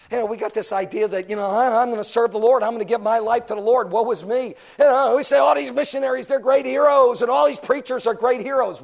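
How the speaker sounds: loud, high preacher's cry; preaching at full force. Acted. Preacher mocks hero talk, loud and high. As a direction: shout the point out.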